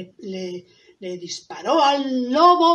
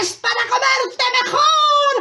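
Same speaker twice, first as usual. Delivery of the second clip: menacing wolf; 9 dB louder than usual. Acted. Wolf about to eat the girl, loud high voice. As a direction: build to a loud high pounce.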